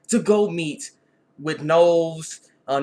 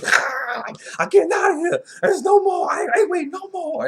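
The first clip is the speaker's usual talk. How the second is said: much higher and louder, a strained put-on voice; king's voice; 13 semitones higher, 5 dB louder than usual. Newborn king brushing off the queen, high strained voice. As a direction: squeak it out like an impatient newborn king. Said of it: "the freaking king's like".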